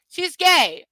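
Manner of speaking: loud, high-pitched outburst; exclaimed at high pitch; incredulous exclamation. Real, not acted. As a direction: blurt it out in disbelief. Announces the obvious reason, incredulous.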